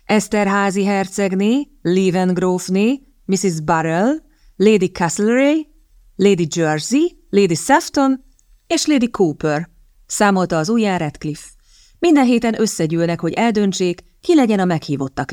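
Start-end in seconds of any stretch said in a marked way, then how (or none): none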